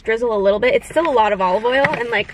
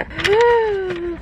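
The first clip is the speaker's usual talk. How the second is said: high, drawn-out gasp; excited gasp; 8 semitones higher than usual. Real, not acted. Vlogger about to open a viewer's shirt, excited gasp. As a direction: gasp with eager anticipation.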